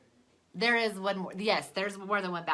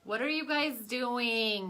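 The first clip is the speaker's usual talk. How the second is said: raised, drawn-out call to another room; 5 semitones higher, 5 dB louder, slower than usual. Real, not acted. Calls to children in another room.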